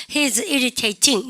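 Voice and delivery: each word drawn out for students to repeat; slow, careful classroom demo sentence; slow model reading. Shown as a demonstration. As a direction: read it very slowly and clearly, like a teacher's model. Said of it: "시작".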